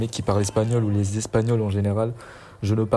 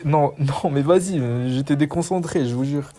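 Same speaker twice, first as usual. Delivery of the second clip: protesting; 5 semitones higher, 4 dB louder than usual. Real, not acted. Protesting he was distracted.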